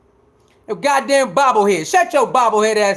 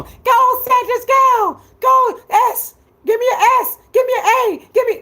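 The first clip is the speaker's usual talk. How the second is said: high mock cheer chant, much higher; mock cheerleader; 11 semitones higher than usual. Mocking a fan cheering with pom-poms. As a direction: cheer him on like a pom-pom girl.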